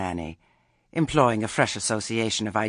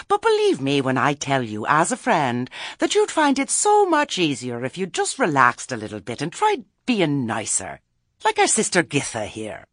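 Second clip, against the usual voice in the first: higher, louder patronizing advice; condescending Lettice; 3 semitones higher, 5 dB louder than usual. Acted. Lettice advising Granny to be nicer, condescending. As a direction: lecture sweetly, as a so-called friend.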